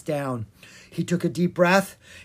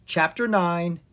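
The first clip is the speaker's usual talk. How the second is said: ordinary delivery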